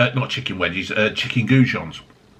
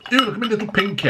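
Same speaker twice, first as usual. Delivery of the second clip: lightly put-on posh voice, pitched up; playing the posh cider sipper for a laugh; mock posh; 8 semitones higher, much faster than usual. Cider reviewer raising his pinky, mock posh. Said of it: "I've gone all posh".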